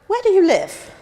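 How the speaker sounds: a hint of eager innocence; imitating husband. Husband asking a total stranger, with eager childlike curiosity. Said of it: "he might say to total strangers".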